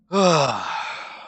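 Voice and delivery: long, falling sigh of defeat; resigned sigh. Real, not acted. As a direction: let out a big sigh, giving up. Giving up on a failed campaign.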